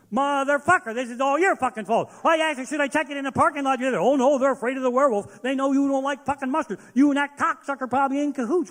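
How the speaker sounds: loud, high enraged tirade at the wife; furious yelling. Retold or imitated. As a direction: blast her with rage, shouting. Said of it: "she would take the brunt of my rage".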